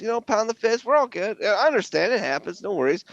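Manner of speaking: high, easygoing mock bro voice; lightly voiced laid-back bro. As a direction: reassure him like a chill guy. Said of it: "And like".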